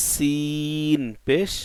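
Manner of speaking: chanted Arabic letter drill; slow drawn-out drill. Shown as a demonstration. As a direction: draw out সিন পেশ like a recitation. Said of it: "আর সিন নিচের দুই দাঁতের".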